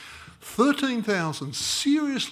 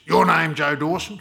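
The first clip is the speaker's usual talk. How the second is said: put-on loud, rasping elderly caller; a very old man on the phone, gravel in the voice; gravelly old man voice; 6 dB louder than usual. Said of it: "the gravelly voice of a very old man".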